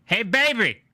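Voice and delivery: high, coaxing comic character voice; Dave Chappelle skit voice. Retold or imitated. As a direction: lure someone over in a comic skit voice. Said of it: "She took a skit from, from Dave Chappelle".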